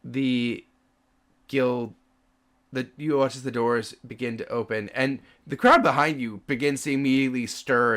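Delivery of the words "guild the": ordinary delivery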